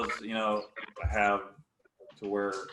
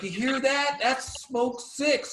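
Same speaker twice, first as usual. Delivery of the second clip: much higher, cocky taunt, six drawn out slowly; imitating Deaver taunting; 12 semitones higher, slower than usual. Deaver taunting the opposing player, much higher cocky voice. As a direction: needle the player in front of you, high and slow. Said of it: "He's like".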